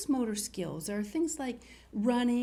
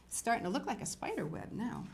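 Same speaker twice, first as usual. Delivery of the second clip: plain reading voice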